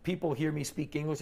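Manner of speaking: normal talking voice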